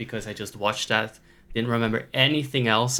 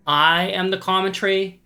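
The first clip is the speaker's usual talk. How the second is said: mock proud; 7 semitones higher, 5 dB louder than usual. Real, not acted. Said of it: "They should shut up".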